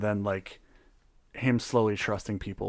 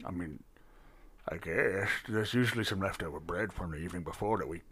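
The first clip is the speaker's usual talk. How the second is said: a hint of a low, soft bartender voice; quiet, murmured innkeeper talk; lightly voiced bartender; 5 semitones lower, 9 dB quieter than usual.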